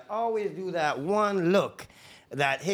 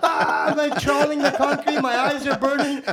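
normal talking voice

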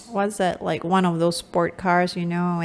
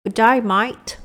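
loud, higher Aussie "Good day, mate"; Australian accent; 4 semitones higher, 4 dB louder than usual. Shown as a demonstration. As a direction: greet like an Aussie. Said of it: "Say "good day, mate" in an Australian accent".

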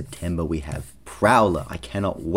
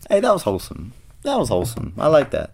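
excited; 7 semitones higher than usual. Real, not acted. Repeats the comment louder, excited.

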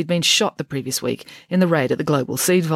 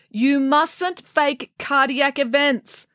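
slow, higher-pitched telling-off; scolding; 10 semitones higher, much slower than usual. Acted. Young doctor to Missus Phillipson over fake alarm, scolding. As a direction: scold with drawn-out, deliberate words. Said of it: "scolded the young doctor".

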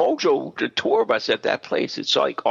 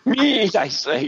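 laughing mock hurt; 5 semitones higher, slower than usual. Real, not acted. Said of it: "that was mean".

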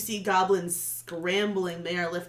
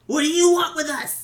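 hostile demand; 7 semitones higher, 8 dB louder, faster than usual. Acted. Goblin interrogates intruders.

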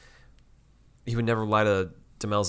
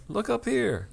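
mock call-out; 14 semitones higher, slower than usual.

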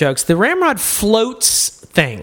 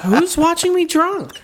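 mock alarmed voice; 11 semitones higher than usual. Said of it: "I was like".

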